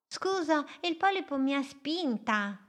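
a small boat dreaming big, spoken up high; little boat's voice. Acted.

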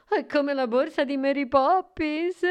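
high, lively elf voice; bright-eyed fairy-tale elf, pitched high; smiling elf. A narrator's reading. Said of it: "L'elfo sorrise".

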